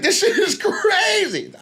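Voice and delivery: high, laughing disbelief; amazed laughing. Retold or imitated. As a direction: marvel at it through a laugh.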